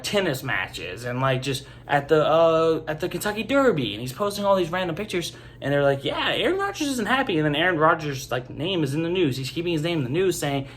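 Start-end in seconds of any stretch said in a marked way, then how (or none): 6.0-7.4 mocking. Mocking the media chatter.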